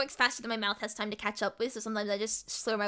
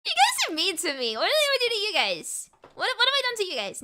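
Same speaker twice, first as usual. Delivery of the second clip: high, louder mock-offended complaint; mock hurt whine; 9 semitones higher, 4 dB louder than usual. Real, not acted. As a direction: playfully protest being picked on. Streamer protests teasing chat.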